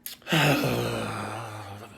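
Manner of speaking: drawn-out breathy sigh of release; long releasing sigh. Acted. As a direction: let it all go in one long sigh. Acts body regulating itself.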